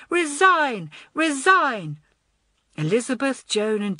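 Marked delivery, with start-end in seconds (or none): 0.1-2.0 high, louder repeated chant; crowd cry. A narrator's reading. Children demanding the head girl step down, chanting cry. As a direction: chant it like protesting schoolchildren. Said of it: "cried the children".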